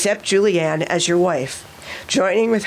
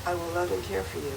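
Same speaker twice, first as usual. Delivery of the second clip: softly fed vow line; quiet prompting; 12 dB quieter, faster than usual. Real, not acted. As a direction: murmur the words for repeating. Officiant feeds vow lines.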